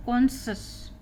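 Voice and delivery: "कॉन्शस" in two beats, kon-shus; pronunciation demo. Shown as a demonstration. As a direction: say "कॉन्शस" with a hushing sh in the second syllable. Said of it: "इसका उच्चारण है".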